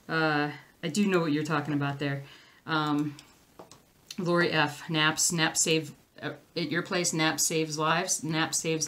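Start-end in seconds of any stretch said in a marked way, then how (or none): none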